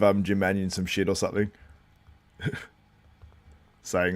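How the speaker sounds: ordinary talk, nothing marked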